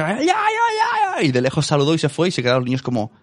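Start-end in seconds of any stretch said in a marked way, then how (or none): 0.0-1.3 put-on high, drawn-out childish wail, much slower; kids wailing. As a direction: wail it out like a startled little kid. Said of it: "y los niños".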